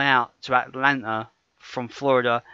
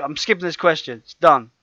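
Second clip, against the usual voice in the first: ordinary talk, nothing marked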